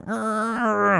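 high playful growl into cat fur; a squeaky affectionate grrr; cute growl. Retold or imitated. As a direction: growl like you're nuzzling kittens. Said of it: "just fucking be all".

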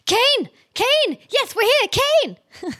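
high-pitched hail to Kane; calling out. Acted. Players hailing Kane outside the house, calling out. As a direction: call her over in a high voice. Player calls out to arriving character.

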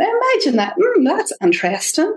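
high, lilting, intrigued musing; interested observer voice. Acted. Speaker voices her internal therapist, interested observer voice. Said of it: "like this interested observer".

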